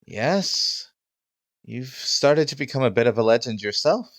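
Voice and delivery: high, put-on admiring voice; high-pitched theatrical flattery; flamboyant host. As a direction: flatter a legend, loud and high. Host flatters the visiting hero.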